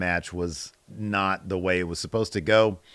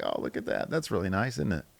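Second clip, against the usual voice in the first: touched aside; 3 semitones lower, 8 dB quieter than usual. Retold or imitated. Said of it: "I was like".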